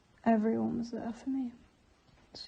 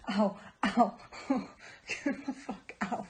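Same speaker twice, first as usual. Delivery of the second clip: loud ow between pained laughs; laughing through pain; 4 dB louder than usual. Real, not acted. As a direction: laugh though it hurts.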